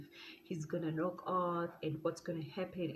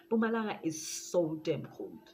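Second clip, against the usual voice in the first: usual speaking voice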